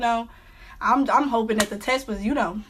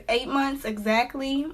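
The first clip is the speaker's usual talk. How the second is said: ordinary delivery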